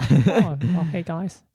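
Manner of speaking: put-on a weak try at the Australian accent. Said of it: "I can't really do that accent".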